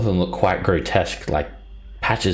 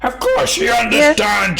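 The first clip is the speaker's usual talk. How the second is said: giant heads in unison; 6 dB louder than usual. Acted. Two heads shouting together that she speaks giant. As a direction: shout it in dumb delight. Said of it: "One of the heads yells out".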